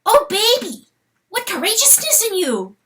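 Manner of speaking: high marveling praise; admiring weasel. Acted. Weasel admiring the fawn, high marveling. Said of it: "he said".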